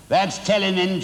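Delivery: a touch of high, gleeful shriek; shrieking Missus Pratchett. A narrator's reading. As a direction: crow with delight at the punishment. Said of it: "shrieked Missus Pratchett".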